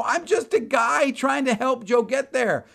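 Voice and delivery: high, loud, drawn-out outburst; exasperated plea. Retold or imitated. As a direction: plead loudly and slowly, at wits' end. Exasperated reply to clerk.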